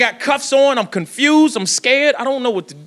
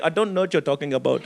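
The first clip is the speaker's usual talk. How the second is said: put-on low, soft Indian accent; Indian accent imitation; 9 semitones lower, 6 dB quieter than usual. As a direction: play it innocent in an Indian accent. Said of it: "He was like".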